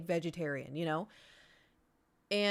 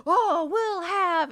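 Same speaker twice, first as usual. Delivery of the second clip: loud, high, stretched-out holler; mock boisterous family shout; rowdy ordering; 10 semitones higher, 10 dB louder, much slower than usual. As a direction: imitate a hooting table calling for drinks. Imitating rowdy family ordering drinks.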